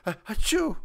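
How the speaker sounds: loud, high mock achoo; fake sneeze. Imitating a sneeze that delights God, loud fake sneeze. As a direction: act out a big sneeze.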